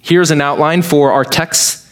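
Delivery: ordinary talk, nothing marked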